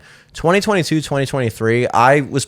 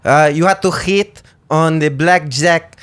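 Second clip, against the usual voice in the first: slow mock Greek accent, Jimmy said as zimmy; 3 semitones higher than usual. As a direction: show how the Greek voice turns Jimmy into zimmy. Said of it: "the J's are z-, Jimmy".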